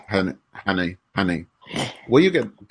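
ordinary talk

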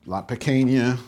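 usual speaking voice

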